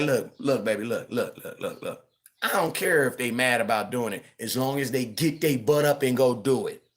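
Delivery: calm her down gently; calm reassuring husband. Retold or imitated. Husband soothing his wife about the kids talking back, soft and low. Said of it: "I be like".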